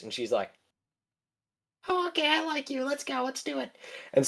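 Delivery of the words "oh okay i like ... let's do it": high, soft mock princess, suddenly charmed; high-pitched smitten princess; sweet princess voice. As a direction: coo it up high like a smitten brat. Princess suddenly agrees with Zenos.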